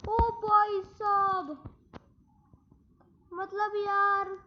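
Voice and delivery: loud, high, slow exasperated cry; frustrated outburst. Real, not acted. Gamer fuming at his team's play, loud frustrated outburst. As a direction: let the frustration burst out loud and drawn out. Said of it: "गुस्सा आ रहा है भाई सही में".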